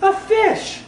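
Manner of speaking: high squeaky leap on "a fish"; sound poetry. Acted. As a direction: jump way up for "a fish". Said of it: "a sound poem by me".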